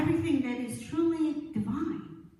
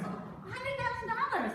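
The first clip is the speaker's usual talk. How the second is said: high-pitched mock astonishment; acted amazement; 10 semitones higher, 4 dB quieter than usual. Old friend hearing of a big inheritance, acted amazement. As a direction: echo the sum in high disbelief.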